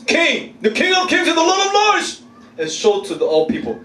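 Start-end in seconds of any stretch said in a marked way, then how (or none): 0.0-2.2 very high, ringing mock-heroic announcement; grand proclamation. Preacher imagining himself transformed to glorious King, grand proclamation. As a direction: proclaim it triumphantly, pitch soaring.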